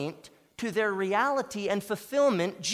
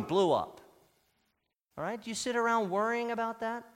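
usual speaking voice

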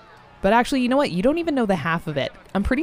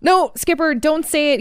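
urgent; 7 semitones higher, 5 dB louder than usual. Acted. Urgent stop.

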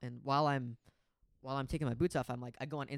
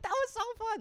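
very high, louder excited quote; excited squeal; 5 dB louder than usual. Retold or imitated. Friend back from jumping in the pit, high excited imitation.

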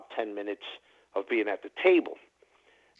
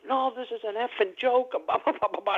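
much higher-pitched mock rant; retold dealer rant; 12 semitones higher than usual. Dealer ranting at the table about his tips, high-pitched mock fury. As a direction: squeal it out like a dealer losing it over tips.

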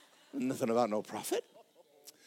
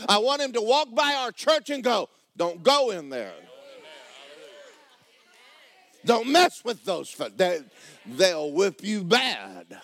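mock devil warning; 5 semitones higher, 5 dB louder, slower than usual. Voicing Satan warning others.

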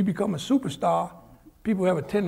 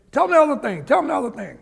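higher, louder pressing, easing on the repeat; urging detective; 6 semitones higher, 4 dB louder, faster than usual. Retold or imitated. Big detective pushing partner to speak, urgent.

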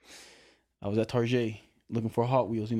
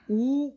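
high, squeamish ooh, much higher; disgusted ooh; 12 semitones higher, 3 dB louder than usual. Retold or imitated. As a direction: recoil in disgust. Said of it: "I'm just".

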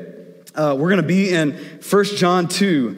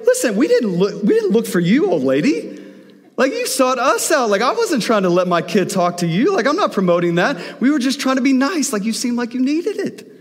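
put-on higher, pushier voice of an annoyed mom telling off the old lady; indignant wife; 7 semitones higher than usual. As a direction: play the wife snapping back, voice pitched well up. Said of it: "my wife was just like".

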